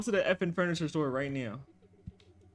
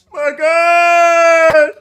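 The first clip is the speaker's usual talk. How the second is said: loud startled shout; 8 semitones higher, 17 dB louder than usual. Real, not acted. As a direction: yell it in alarm. Shouting as the chaser comes.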